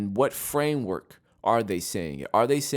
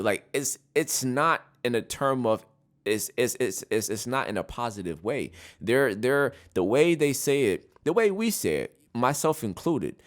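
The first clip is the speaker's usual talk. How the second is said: ordinary talk, nothing marked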